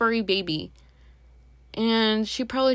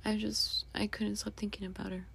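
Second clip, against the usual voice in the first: whisper; 10 dB quieter than usual. Real, not acted. Grief over grandma.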